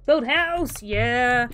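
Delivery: loud, high, blunt caveman words; loud caveman cheer. Pretend. Silly self-cheer while building.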